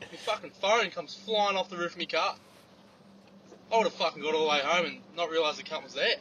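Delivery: ordinary delivery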